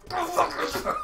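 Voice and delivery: high, loud cursing with a laugh; mock rage. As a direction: yell it half laughing.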